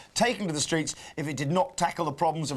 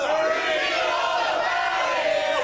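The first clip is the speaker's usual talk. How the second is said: high drawn-out street shout; newspaper seller cry; 11 semitones higher, much slower than usual. Shown as a demonstration. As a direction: bellow it slowly across the street. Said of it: "We're gonna do that again".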